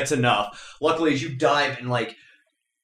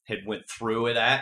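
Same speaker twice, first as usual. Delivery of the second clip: ordinary talk, nothing marked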